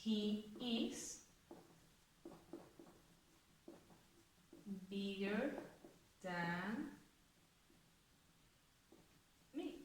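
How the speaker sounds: soft, slow dictation of the example; soft, drawn-out dictation. Shown as a demonstration. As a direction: murmur each word slowly while writing. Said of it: "Vamos a ponerla en una frase".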